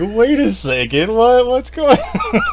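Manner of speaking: high, slow, laughing disbelief; mock bewilderment. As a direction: retell being thrown, stretching the words upward. Retells own reaction to shocking scene.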